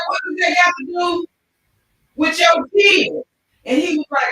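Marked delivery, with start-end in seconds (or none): 0.1-3.2 high, loud, incredulous demand; retold angry question. Driver confronting his passenger, high and loud. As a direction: bark it out like the outraged driver. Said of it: "He said".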